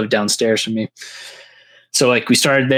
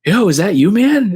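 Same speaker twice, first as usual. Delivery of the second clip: higher, louder call of recognition; light mock call to a friend in the dark; surprised call-out; 9 semitones higher, 4 dB louder than usual. Stoned teen recognizes his friend, calling out higher.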